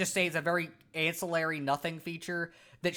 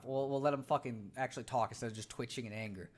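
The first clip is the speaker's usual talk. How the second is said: ordinary talk, nothing marked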